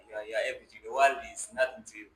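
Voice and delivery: ordinary talk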